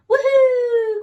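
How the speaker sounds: high, loud joyful woohoo; excited whoop. Acted. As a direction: cheer like a little penguin flying. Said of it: "cried little Pip Pip".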